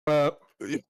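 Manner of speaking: hiccup jolts the words; talking between hiccups. Real, not acted. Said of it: "why have I got hiccups now".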